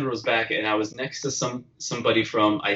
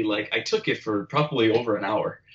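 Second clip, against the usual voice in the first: ordinary talk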